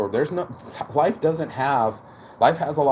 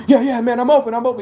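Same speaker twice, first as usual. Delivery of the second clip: high, loud eager yelling for the ball; loud excited calling; 12 semitones higher, 7 dB louder, faster than usual. Retold or imitated. Teammate yelling to get open, loud and high. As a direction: shout to get the pass. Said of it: "He said it all loud".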